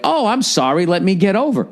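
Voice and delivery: high, put-on apology; high-pitched parody of a sorry motorist; mock apologetic driver. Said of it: "made the person in front of me go like".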